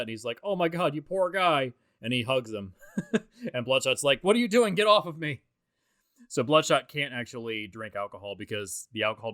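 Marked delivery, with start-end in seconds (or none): 0.4-1.7 higher, mildly hammed-up pity; upset sympathy. Armstrong pitying Bloodshot before a hug, mock-upset sympathy. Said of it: "Armstrong gets really upset". 4.2-5.4 higher, louder indignant objection; mock protest. Bloodshot to Armstrong who hugs him, acted loud protest.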